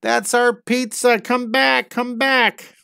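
high, loud calling after the pizza; high-voiced mock plea to come back; pleading villager. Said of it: "He's like".

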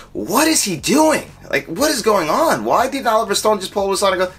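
high-pitched, incredulous re-enacted complaint; exasperated disbelief. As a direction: question it as if utterly baffled. Re-enacting disbelief at actor's accent.

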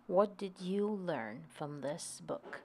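usual speaking voice

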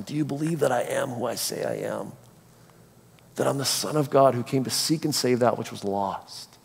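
hushed, sincere pleading; tender earnest appeal. Acted. Jesus asking disciples to trust him, gently earnest. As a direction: softly press the question home.

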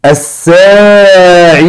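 الساعي ending in a long "ee"; ya pronounced ya. Shown as a demonstration. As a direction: stretch الساعي slowly, final ي held as "ee". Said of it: "دي اتنطقت ياء".

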